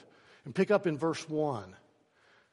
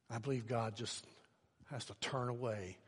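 sorrowful fade; 5 semitones lower, 12 dB quieter than usual. Real, not acted. Grieving over the lost.

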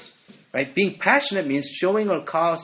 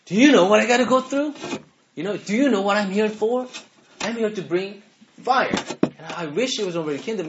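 louder, higher exasperated outburst; venting; 6 semitones higher, 5 dB louder than usual. Retold or imitated. Jesus venting to disciples who don't understand, exasperated loud outburst. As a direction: vent it like you're fed up and unheard. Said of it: "it almost seems like Jesus is venting".

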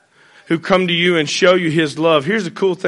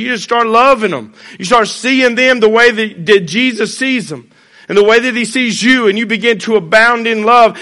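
loud, raised preaching voice; full-force preaching; 6 semitones higher, 5 dB louder than usual. Acted. Pastor urging the church to love, in full preaching voice. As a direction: drive the point home to the congregation.

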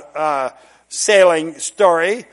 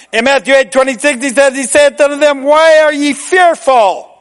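full-force preaching; 7 semitones higher, 5 dB louder than usual. Acted. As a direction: preach Why are ye fearful with weight. Preaching, Jesus' words drawn out.

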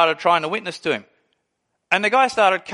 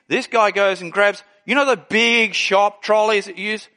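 normal talking voice

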